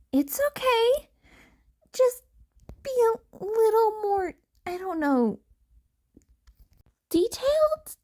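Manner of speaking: reassuring Uraraka. Acted. Uraraka forgives Izuku but wants details, gently insistent.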